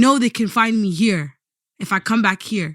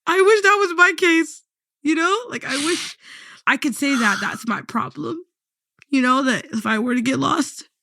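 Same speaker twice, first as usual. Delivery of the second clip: higher, wavering wish; emotional; 6 semitones higher than usual. Real, not acted. Host wishing she were as close to the Quran, emotional. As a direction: hold back tears while you say it. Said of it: "I'm getting emotional".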